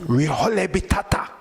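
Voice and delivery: name said with a Spanish lilt; Spanish-flavoured pronunciation of "Riojole Bitata"; Spanish twang. Shown as a demonstration. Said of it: "You gotta say that with a little Spanish twang".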